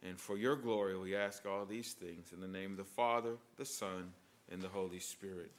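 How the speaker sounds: hushed, low prayer voice; quiet reverent prayer. Acted. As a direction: pray softly before the congregation. Closing prayer.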